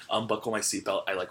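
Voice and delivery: ordinary delivery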